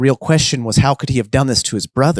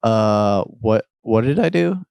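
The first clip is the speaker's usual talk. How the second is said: slow, uncertain, caught off guard; nervous confusion; slower than usual. Acted. Damien is accused by Best, nervously confused.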